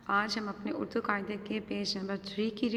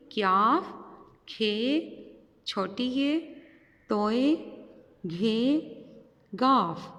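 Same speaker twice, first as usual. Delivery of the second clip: letter drill; much slower than usual. Shown as a demonstration. Teacher calling letters for children to follow, drill-style. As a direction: drill each letter for the class. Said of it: "फिर reading करते हैं इसकी".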